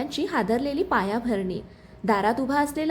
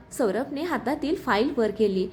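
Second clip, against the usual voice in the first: ordinary delivery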